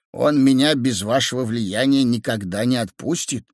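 plain reading voice

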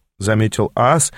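ordinary delivery, nothing marked

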